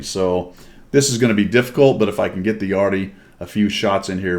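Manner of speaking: ordinary talk, nothing marked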